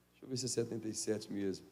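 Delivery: low, soft, quick check; muttered aside. Real, not acted. Pastor checking the psalm number to himself. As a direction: mumble to yourself while looking it up.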